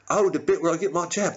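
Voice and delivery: high, quick sleeper's reply; roused sleeper. Acted. Sleeper answering Britain about the jab, roused and quick.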